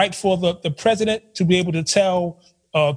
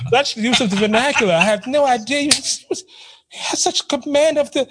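posh mock coworker; 7 semitones higher than usual. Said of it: "mocking the coworker's amazement".

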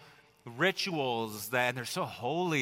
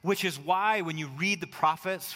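usual speaking voice

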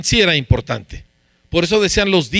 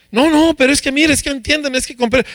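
higher-pitched, flustered excuse-making; excusas atropelladas; 7 semitones higher than usual. Acted. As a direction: act out Saul squirming with excuses. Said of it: "en vez de dar excusas". (excusándose a la defensiva)

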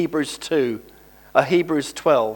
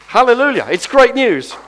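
loud, high burst of praise; exultant proclamation; 8 semitones higher, 7 dB louder than usual. Real, not acted. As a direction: proclaim it jubilantly to the congregation. Preacher exulting over good news.

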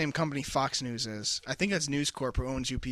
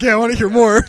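loud, eager mock voice; mock eager shout; 7 semitones higher, 14 dB louder, faster than usual. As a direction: shout it like a bored guy perking up. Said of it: "Jack's like".

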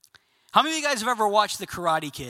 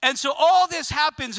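usual speaking voice

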